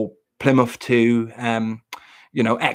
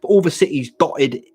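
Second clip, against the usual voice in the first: ordinary talk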